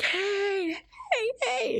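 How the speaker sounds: high, laughing hey hey hey; cheery hey. Retold or imitated. People wanting her bubbly greeting, high and laughing. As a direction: greet them all bright and bubbly. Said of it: "they want to see you be like".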